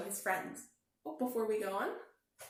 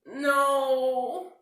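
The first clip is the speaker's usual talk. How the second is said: upset no; 4 semitones higher, 12 dB louder than usual. A narrator's reading. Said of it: "said Douglas".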